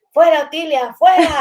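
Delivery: loud, high mock exorcist's cry; mock exorcism shout. Friend chases the mother's spirit out, mock shouting. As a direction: cast the mother out, hammed-up and loud. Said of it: "venía y me decía".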